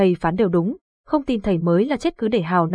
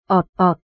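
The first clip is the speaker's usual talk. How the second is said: louder gurgling belly sound; stomach rumble noise; 4 dB louder than usual. A narrator's reading. Narrator imitates the hungry boy's stomach growling, gurgling.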